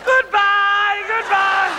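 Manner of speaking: high, loud, sing-song goodbye; a teasing wave of a goodbye called upward; loud mock farewell.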